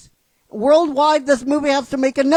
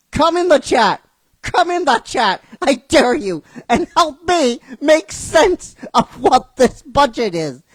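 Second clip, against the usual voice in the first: ordinary delivery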